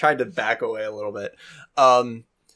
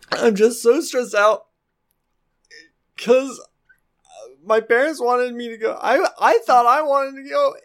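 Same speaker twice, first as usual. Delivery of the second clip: halting sobs between broken phrases; high, stressed-out crying voice; acted weeping; 10 semitones higher than usual. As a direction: choke out excuses through tears. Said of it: "me weeping into the mic".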